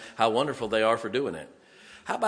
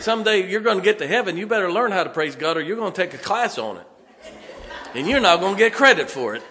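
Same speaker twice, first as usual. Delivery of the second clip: louder, higher preaching, fast at the start; 6 semitones higher, 6 dB louder, faster than usual. Acted. Preacher warning the congregation about heaven, loud laughing preaching. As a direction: drive it home from the pulpit with a laugh.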